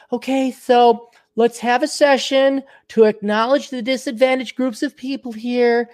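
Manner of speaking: high, sing-song parody voice; mock session facilitator. Activist opening a session for disadvantaged groups, mocking high voice. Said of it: "They're instead gonna be like".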